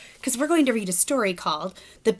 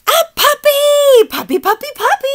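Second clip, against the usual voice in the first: excited shout; 11 dB louder than usual. Acted. Pigeon announcing his wish, shrieking with joy. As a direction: scream it like the best wish ever.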